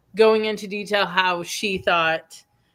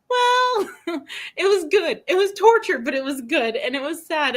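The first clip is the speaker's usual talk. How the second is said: mock distraught; 7 semitones higher than usual. Quoting herself after finishing book.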